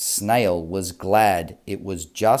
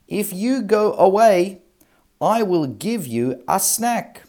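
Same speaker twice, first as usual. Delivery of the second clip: Snail bargaining; 10 semitones higher, 4 dB louder than usual. Acted.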